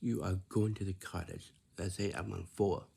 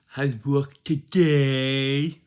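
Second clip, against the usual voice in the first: very loud, high, drawn-out silly voice; over-the-top silly shouting; silly talk; 5 semitones higher, 14 dB louder, much slower than usual. Shown as a demonstration. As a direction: blare it out goofily, stretching the last word. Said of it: "you are talking silly to your dad".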